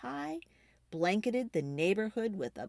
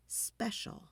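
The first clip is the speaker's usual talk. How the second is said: whispered; 2 semitones higher, 9 dB quieter than usual. A narrator's reading. Oven dial label, whispered.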